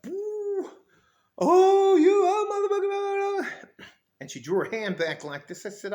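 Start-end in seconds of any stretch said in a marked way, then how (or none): 0.0-3.8 very high, wailing mock rant; loud squealing caricature of someone cursing; mock outburst. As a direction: imitate an irate woman in an absurd falsetto. Mocking the woman's wild outburst.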